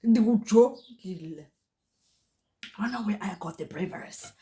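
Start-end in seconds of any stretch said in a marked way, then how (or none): 0.0-1.5 slow, stern, louder "Ndikujongile"; each syllable of "Ndikujongile" drawn out as a threat; home-style Xhosa firmness, drawn slow; slow stern Xhosa warning. Retold or imitated. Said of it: "Esasixhosa samakhaya".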